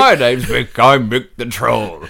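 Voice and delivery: slow, hammed-up troll voice; old troll. Troll introducing himself, slow comic troll voice. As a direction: introduce yourself as a lumbering troll. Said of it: "a massive old grumpy troll".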